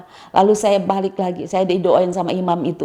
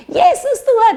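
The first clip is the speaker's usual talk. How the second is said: high, loud cry of faith; jubilant shout; 7 dB louder than usual. Retold or imitated. Woman embraced by a huge hand, shouting joyfully. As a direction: shout it out fearlessly, pitch soaring. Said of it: "langsung saya berteriak".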